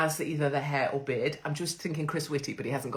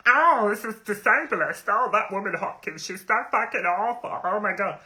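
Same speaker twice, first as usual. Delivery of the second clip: louder, higher, huffy complaining voice; mocking outraged critic; 4 semitones higher, 4 dB louder than usual. Mocking critics who call her ableist, in a louder, higher huffy voice. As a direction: ham up an offended online critic. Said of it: "just before we all get into".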